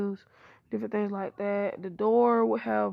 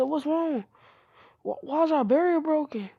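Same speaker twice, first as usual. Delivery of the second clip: alarmed questioning; 6 semitones higher, 5 dB louder than usual. Acted. Naruto startled awake, barrier broken.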